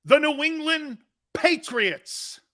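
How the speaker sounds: slow, drawn-out ranking reveal; countdown announcer. Acted. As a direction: build suspense on each number.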